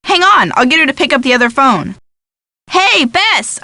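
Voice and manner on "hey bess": high-pitched call to someone off the line. Acted. George calls Bess to pick up the other phone, calling out. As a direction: call across to a friend, pitched up.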